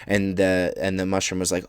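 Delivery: ordinary delivery, nothing marked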